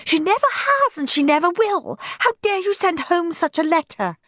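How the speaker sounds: high, indignant shriek; shrieking mother. A narrator's reading. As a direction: shriek it outraged. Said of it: "shrieked Moody Margaret's mother".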